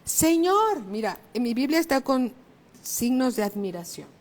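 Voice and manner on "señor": louder, higher call to the Lord; grito de auxilio. Acted. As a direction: call out loudly as Peter did. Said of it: "como que gritó". (exclamación fuerte, voz alzada)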